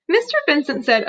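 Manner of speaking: ordinary delivery, nothing marked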